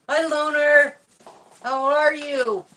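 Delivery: high, bright sing-song greeting; talking sweetly to a pet. Real, not acted.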